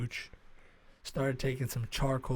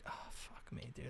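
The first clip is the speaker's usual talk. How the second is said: whispered mock dismay; very soft, voiceless grumble; muttered aside; 3 semitones lower, 20 dB quieter than usual. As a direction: curse under your breath. Dismayed aside during the call.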